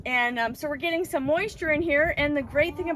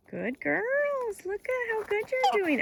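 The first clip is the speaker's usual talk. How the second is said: higher, sing-song praise for dogs; 10 semitones higher, slower than usual. Real, not acted. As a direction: coo at the puppies. Baby talk praising the dogs.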